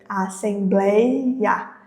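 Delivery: "Assembleia" stretched out very slowly, each syllable apart; slow syllable demo. Shown as a demonstration. Teacher to students, showing the syllable break, slowly. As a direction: sound out "Assembleia" to show the hiatus. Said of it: "uma divisão silábica aí".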